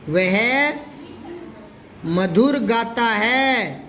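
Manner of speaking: drawn-out words with a long pause; measured, stretched classroom dictation; slow dictation. Shown as a demonstration. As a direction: dictate it slowly for pupils to write. Teacher dictates the example slowly.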